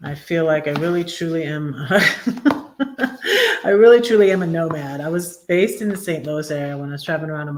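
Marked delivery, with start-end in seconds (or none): 1.8-3.6 short laugh, higher; high self-mocking laugh. Real, not acted. Streamer laughing about being a nomad, high giggle. 3.6-5.0 louder and quicker, amused; amused admission. Real, not acted. Telling viewers she is a nomad, amused and louder.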